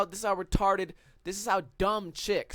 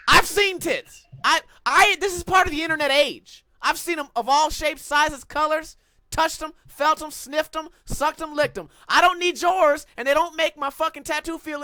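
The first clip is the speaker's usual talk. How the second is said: worked-up rant; 7 semitones higher, 4 dB louder than usual. Real, not acted. Heated rant.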